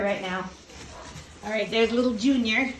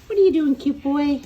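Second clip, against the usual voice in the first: doting pet voice; puppy talk; 6 semitones higher, 6 dB louder, faster than usual. Real, not acted. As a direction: coo at the puppy. Cooing at puppy.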